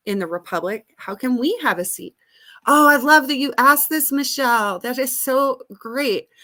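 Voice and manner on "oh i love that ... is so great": delighted. Real, not acted. Delighted by a caller's question.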